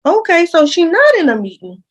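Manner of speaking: high, pointed gotcha; sarcastic realization. Real, not acted. Telling viewers the principal lied, knowing tone. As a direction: lean on "not" like you caught them. Catching the school in a lie.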